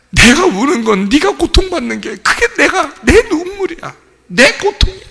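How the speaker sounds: high, impassioned cry in God's words. Retold or imitated. God telling sufferer their pain is His, anguished high cry. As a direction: voice divine anguish at a high pitch. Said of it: "근데 하나님은".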